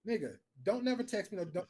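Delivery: usual speaking voice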